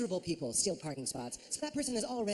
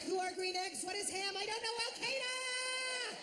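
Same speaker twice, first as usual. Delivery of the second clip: high, hammed-up frightened squeal; comic panicked wail; mock panic; slower than usual. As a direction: squeal it higher and higher, stretching the end. Acts out panicked answer to security question.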